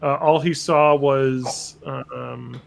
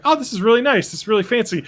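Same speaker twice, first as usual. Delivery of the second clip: high, delighted gasp at a fancy house; mock impressed; 7 semitones higher than usual. Viewer browsing house photos, mock impressed. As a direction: gush over how nice it looks.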